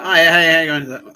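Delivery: loud, high mock-friendly hello; fake cheery greeting. Greeting the pub manager with loud fake cheer.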